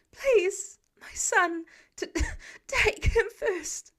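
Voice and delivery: very high, loud breaking plea; panicked mother. A narrator's reading. Trapped mother begging Spider-Man to take her son, panicked. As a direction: beg through panic to save the child.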